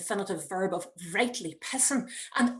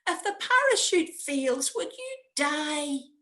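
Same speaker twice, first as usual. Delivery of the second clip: put-on higher child's voice, slowing on the last words; worried child; 7 semitones higher than usual. Son asking his mother about dying, worried child. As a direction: ask it as an anxious, blunt boy. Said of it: "says my son".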